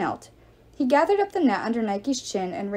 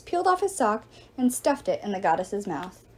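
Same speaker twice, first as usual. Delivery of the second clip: usual speaking voice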